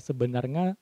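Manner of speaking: "sebenarnya" with a final "-nga"; unclear Acehnese pronunciation. Shown as a demonstration. Trainer showing Aceh-accented Indonesian, "sebenarnya" ending "-nga". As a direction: turn the ny of "sebenarnya" into ng. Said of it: "pengucapannya itu kurang jelas".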